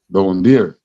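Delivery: ordinary delivery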